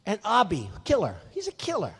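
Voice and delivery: ordinary talk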